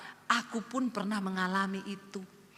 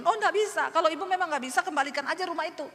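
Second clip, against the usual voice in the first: high, louder curt refusal; 7 semitones higher, 3 dB louder, faster than usual. Retold or imitated. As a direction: snap back at the debtor. Landlord refuses, angry.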